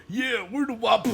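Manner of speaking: high put-on voice of a cocky posse member; lightly voiced swaggering outlaw; mock gang member. Narrating a game, voicing the posse in a mock high voice.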